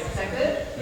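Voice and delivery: ordinary talk, nothing marked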